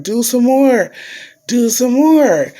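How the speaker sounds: high, insistent imitation of a doctor; doctor giving orders. Doctor asking for a third dose, high mock command.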